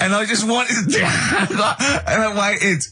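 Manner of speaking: high, helpless laughter through the words; laughing. Real, not acted. Comic telling his phone-in story, really laughing through it. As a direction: struggle to talk while cracking up. Cracking up over own story.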